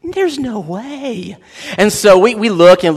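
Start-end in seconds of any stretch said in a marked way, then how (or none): 0.0-1.4 slow, soft disbelieving there's no way; drawn-out inner skepticism; mild disbelieving thought; doubtful. Retold or imitated. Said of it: "I'm thinking".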